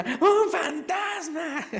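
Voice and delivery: high, slow frightened cry; mock terrified shout. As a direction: gasp it out like seeing a ghost. Disciples see a ghost. (grito de susto fingido de los discípulos)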